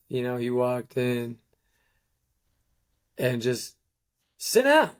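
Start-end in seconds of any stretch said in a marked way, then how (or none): 4.4-4.9 hurler l'ordre très haut et fort; coach yelling. Retold or imitated. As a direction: aboie comme un coach furieux. Coach yelling in locker room.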